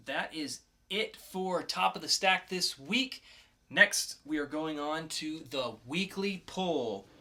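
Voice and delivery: punchy host voice kicking off the poll segment; show announcer. Acted.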